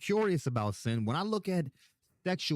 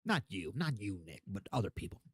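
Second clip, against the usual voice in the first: softer, lower reassurance; quieter aside; 6 semitones lower, 7 dB quieter than usual. Real, not acted. Reassuring Nick it is not him, softer. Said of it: "softly reassuring Nick".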